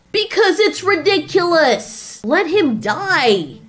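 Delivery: high exasperated cry, slowing on the last words; yelling. Acted. Godzilla answers why he is yelling, exasperated yelling. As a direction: yell it, then drag out the verdict. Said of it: "Why are you yelling?".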